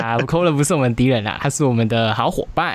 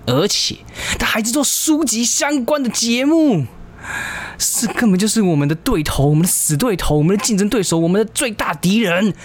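mock alarmed; 8 semitones higher than usual. Mock alarmed rival announcement.

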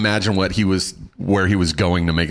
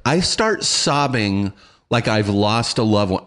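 usual speaking voice

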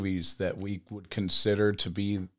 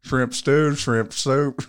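higher, louder Southern Bubba voice; Bubba impression; 5 semitones higher, 5 dB louder, much slower than usual. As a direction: rattle off shrimp dishes like Bubba. Quoting Bubba's shrimp list.

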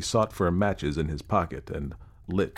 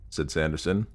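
plain reading voice

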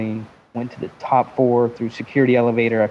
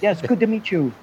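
higher mock Japanese accent; 6 semitones higher than usual. Host meeting Japanese businessmen, higher mock Japanese accent. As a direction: greet them in an imitated Japanese accent, pitched up. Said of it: "if I bring some Japanese".

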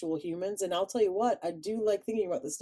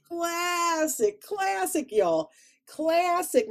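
put-on high, drawn classic in Chicago accent; hammed-up Chicago classic; 11 semitones higher, 5 dB louder, much slower than usual. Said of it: "my Chicago accent came out".

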